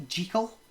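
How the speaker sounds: "Jekyll" said as JEE-kul; intended pronunciation. Shown as a demonstration. Host sharing trivia on the author's intent, "Jekyll" as JEE-kul. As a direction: make the first syllable of "Jekyll" rhyme with seek. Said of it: "it's meant to rhyme with hide and seek".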